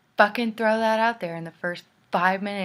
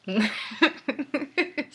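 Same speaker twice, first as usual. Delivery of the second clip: higher-pitched giggle; helpless little laugh; 7 semitones higher than usual. Real, not acted. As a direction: crack up at your own bad accent. Cracks up at own accent.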